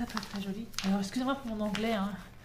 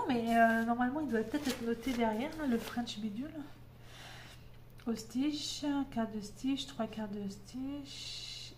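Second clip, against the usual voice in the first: ordinary talk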